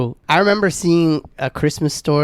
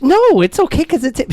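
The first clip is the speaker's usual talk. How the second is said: pitched-up protest; high defensive insistence; 12 semitones higher, 4 dB louder than usual. Retold or imitated. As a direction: brush it off, half-laughing. Defending himself.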